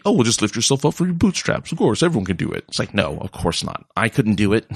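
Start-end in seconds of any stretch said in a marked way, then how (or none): 0.1-2.7 lightly raised, breezy mock advice; a hint of a smug know-it-all; mock glib advice. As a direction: toss it off like an easy fix, half-mocking. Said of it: "dumb of me to say".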